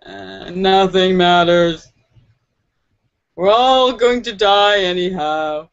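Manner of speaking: low, slow mopey emo drawl. Acted. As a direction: play the hopeless emo kid. Continues emo voice.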